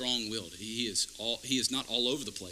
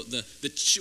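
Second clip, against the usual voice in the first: ordinary talk, nothing marked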